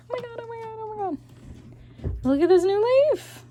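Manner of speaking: high, squealing delight at a leaf; talking to a plant. Real, not acted. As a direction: gush at the plant, thrilled. Sees the plant's new leaf.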